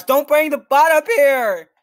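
loud, high, slowed pleading; frantic shout at a teammate; panicked plea. Real, not acted. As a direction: beg them loudly to keep the bot away. Teammate leads bot toward him.

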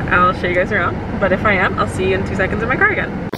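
ordinary delivery

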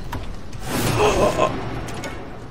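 high breathy gasp; startled gasp. Real, not acted. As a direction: gasp in sudden fright. Startled during horror game.

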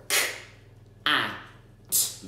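K, a, t each sound alone with gaps. Shown as a demonstration. Parent sounding out cat for child, separated sounds. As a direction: segment cat sound by sound with pauses. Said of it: "you as an adult might go".

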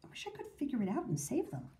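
soft, hurried private wish; talking to herself. A narrator's reading. Amanda wanting to save rabbits, soft self-talk. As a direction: wish quietly, just to yourself. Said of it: "she said to herself".

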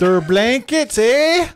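put-on high northern accent, rising sharply on "eh"; attempted northern accent. Comic repeats the blankets punchline, hammed-up northern accent. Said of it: "I can't do that fucking northern accent".

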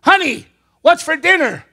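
high, loud acted call. Husband testing wife's hearing from behind, loud high call. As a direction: call to someone across the room. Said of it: "He gets five feet behind her".

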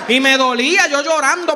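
ordinary delivery

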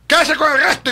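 mocking showoff. Mocking the rich man's show.

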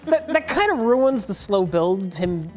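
ordinary talk, nothing marked

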